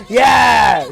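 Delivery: ordinary talk, nothing marked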